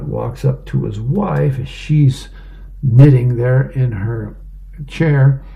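ordinary delivery

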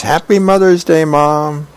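high, eager greeting; excited child. Acted. Hammy rushing up to Mom, excited. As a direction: call it out high like an eager kid.

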